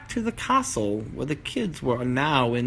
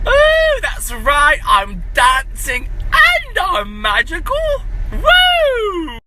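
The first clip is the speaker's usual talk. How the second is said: high, loud, squealing dancing bee; bumblebee voice; 10 dB louder, much slower than usual. Acted. Magical bumblebee shouting with joy to the rabbit. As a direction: shriek it like a giddy little bee.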